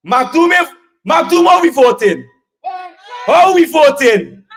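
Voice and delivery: rally call to crowd. Real, not acted.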